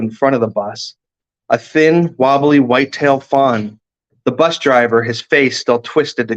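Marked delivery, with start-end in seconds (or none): none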